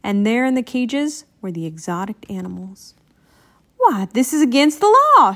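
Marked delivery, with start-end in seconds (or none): 3.8-5.3 raised, high-pitched protest; loud, high exclamation of objection; indignant shout. A narrator's reading. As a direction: call it out like an outraged reporter. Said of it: "shouted a reporter".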